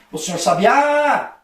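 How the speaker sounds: high, drawn-out call with a long final "sabiá"; demonstrated call stretching the last syllable. Shown as a demonstration. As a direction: holler for someone outside their house. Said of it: "na frente da casa do sabiá e cobrar ele".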